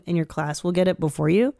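ordinary delivery

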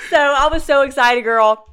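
laughing to a friend about looking like a beauty queen; laughing through words. Real, not acted. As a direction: gasp the words out mid-laugh. Still laughing while talking.

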